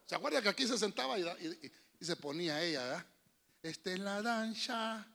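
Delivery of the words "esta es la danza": lightly voiced old granny without teeth; toothless old lady. Pastor mimicking a toothless old church lady, lightly gummy. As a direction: mumble it like a toothless grandma. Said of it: "sin dientes".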